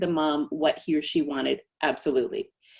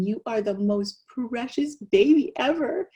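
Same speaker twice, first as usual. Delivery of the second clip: put-on higher-pitched baby talk; doting daddy; 5 semitones higher than usual. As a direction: coo adoringly at your baby. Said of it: "daddy is looking at the baby saying".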